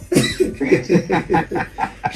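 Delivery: laughing. Real, not acted. Laughs after "I'm still drinking.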